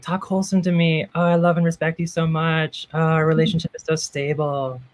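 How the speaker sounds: hammed-up seductive coo; mock sultry wholesome. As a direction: coo the wholesome lines like dirty talk. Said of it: "we're like".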